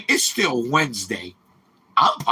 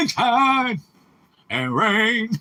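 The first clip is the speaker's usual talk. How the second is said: lightly voiced old man, higher; a hint of an old man singing; 7 semitones higher, slower than usual. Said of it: "That's my old man voice".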